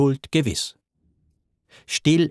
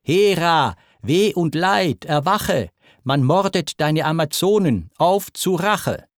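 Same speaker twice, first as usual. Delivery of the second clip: a god shouting for revenge, slowing on the last words; mighty roar; 5 semitones higher than usual. Acted. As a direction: roar it up to wake the house. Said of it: "Dann brüllt er mächtig".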